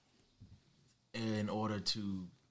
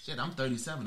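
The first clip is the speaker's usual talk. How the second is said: usual speaking voice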